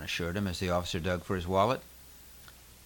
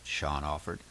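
usual speaking voice

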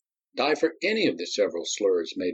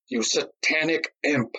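higher, slowed, hissed insult; slow furious insult; 7 semitones higher, slower than usual. A narrator's reading. Nadi cursing Darius, furious. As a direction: lean on every word.

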